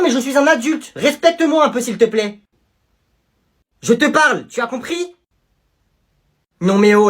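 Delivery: sermon sévère et fort; la prof recadre l'élève; stern scolding teacher. Acted. Teacher scolding student loudly. (prof furieuse qui recadre)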